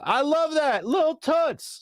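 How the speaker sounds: high put-on voice gushing over the nickname; imitating Trey Thomas. Friend Trey Thomas loving the nickname, high gushing voice. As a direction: squeal it like an excited friend. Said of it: "was like".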